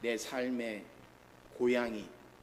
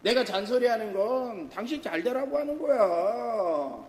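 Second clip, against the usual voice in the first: 배우자 흉내; 7 semitones higher, 9 dB louder than usual. Retold or imitated. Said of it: "내 배우자가 그런 말도".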